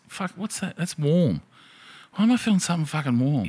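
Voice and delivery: a retold jolt of panic at something warm; alarmed surprise. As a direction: wake up alarmed.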